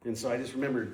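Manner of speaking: ordinary talk, nothing marked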